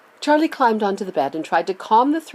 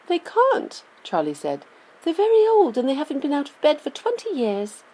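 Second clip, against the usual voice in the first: put-on high, earnest child's voice; young boy explaining; 8 semitones higher than usual. A narrator's reading. Charlie voiced by narrator.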